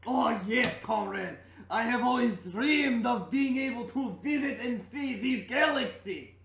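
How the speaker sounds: loud, high Russian comrade voice; booming eager Russian NPC; Russian accent. Acted. NPC Vladimir answers.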